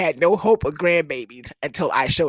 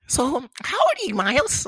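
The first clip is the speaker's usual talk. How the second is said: high, quick questioning; girlfriend's mama; 9 semitones higher than usual. Retold or imitated. Mama quizzing daughter's boyfriend, high quick voice. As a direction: imitate a mother in a raised voice. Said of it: "And she goes".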